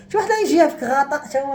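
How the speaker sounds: usual speaking voice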